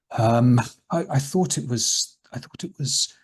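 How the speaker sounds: halting words trailing off unvoiced; hesitant, fading. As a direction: falter and trail off into a breath. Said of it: "he hesitates".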